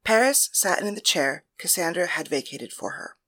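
usual speaking voice

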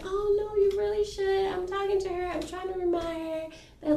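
high-pitched imitation of a friend; retold pleading friend. Friend urging her to move in, high imitated pleading. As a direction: pitch it up as the well-meaning friend.

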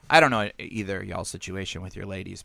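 normal talking voice

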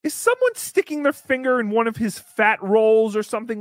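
ordinary talk, nothing marked

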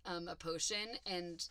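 ordinary talk

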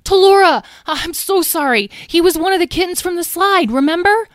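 high-pitched anxious apology; high, urgent explaining; apologetic pleading. A narrator's reading. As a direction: plead your case to an angry friend. Tom apologises after being yelled at.

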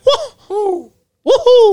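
very high falsetto whoops; gleeful cartoon cheer; Mario whoop. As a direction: squeal like a video game hero. Auditioning as Mario.

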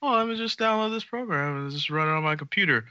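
breezy, clueless "just run it" tone; naive newbie. Retold or imitated. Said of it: "thinks making Bitcoin is as easy as like".

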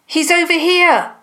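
high-pitched call across a clearing; high, carrying shout-out. A narrator's reading. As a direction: call out to point someone out. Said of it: "Matilda called".

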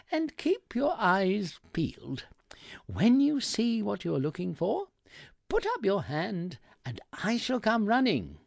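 high, mock-eager servility; obsequious sarcasm. A narrator's reading. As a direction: patronise the customer with exaggerated helpfulness. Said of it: "using an obsequious tone to compliment his sarcasm".

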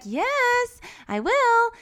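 high, mock-patient reply; put-on high-pitched agreement; placating dad. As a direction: give in with an exaggerated high voice. Voicing father answering nagging son.

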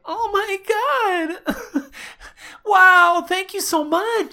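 high, loud, weepy thanks; tearful joy. Pretend. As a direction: gush with overjoyed tears. Role-play, overcome by the news.

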